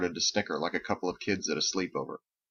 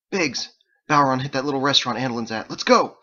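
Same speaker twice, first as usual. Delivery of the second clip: loud, fast, higher call to action; urgent; 6 semitones higher, 7 dB louder than usual. A narrator's reading. Wells runs up to Biggs with news, urgent. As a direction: rush the news out, ending on a shout.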